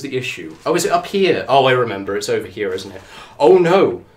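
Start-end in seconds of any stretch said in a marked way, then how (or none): 3.4-4.0 loud, raised cry of alarm. Real, not acted. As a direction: cry out in dismay. Alarmed at a problem.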